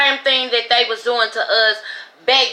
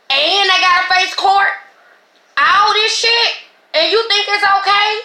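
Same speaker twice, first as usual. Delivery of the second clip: louder, higher outrage building over the unfairness; outraged rant; 8 semitones higher, 3 dB louder than usual. Real, not acted. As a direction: push it up, really angry at them. Outraged rant about the raids.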